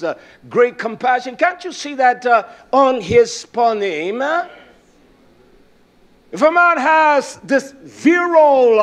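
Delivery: rhythmic preaching with stretched phrases and "uh" breaks; rising, chanted preacher cadence; fervent preaching. Real, not acted.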